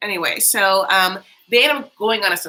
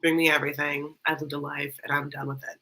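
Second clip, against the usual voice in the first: bored mom; 5 semitones lower, 6 dB quieter than usual. Retold or imitated. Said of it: "does not care about anything".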